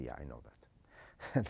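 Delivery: low, slow, soft shrug of a reply; mock bored student. Teacher imitating a student who thinks it obvious, low and bored. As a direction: play it as a student unimpressed. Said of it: "You say".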